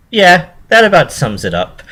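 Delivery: pony accepting the challenge with swagger; confident. A narrator's reading. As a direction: answer cocky, almost giggling. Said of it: "with a confident giggle".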